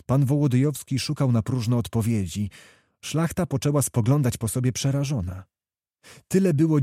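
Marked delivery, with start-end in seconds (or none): none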